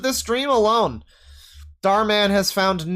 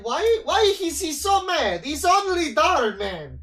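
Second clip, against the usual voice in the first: a higher, lightly put-on voice of chat viewers; mock chat voice; 7 semitones higher than usual. Streamer voicing chat questioning his anger, lightly mocking. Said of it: "they'll be like".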